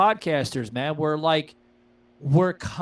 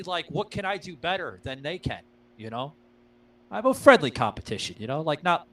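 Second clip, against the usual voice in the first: ordinary talk, nothing marked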